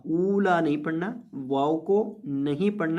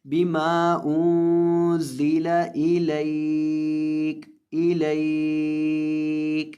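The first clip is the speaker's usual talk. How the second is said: slow, drawn-out tajweed chant; Quran recitation; 3 dB louder, much slower than usual. Shown as a demonstration. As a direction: recite each word stretched and melodic.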